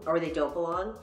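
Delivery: usual speaking voice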